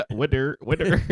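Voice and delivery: "Weather" said as "wider" with a laugh; imitating pronunciation. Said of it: "Under the weather".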